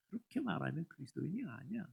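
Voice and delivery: ordinary delivery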